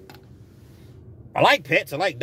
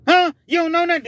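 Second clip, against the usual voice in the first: mock interrogation; 12 semitones higher, 5 dB louder, faster than usual. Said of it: "He even questioned his girl".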